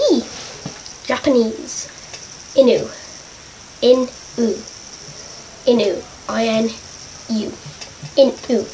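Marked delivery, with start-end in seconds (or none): none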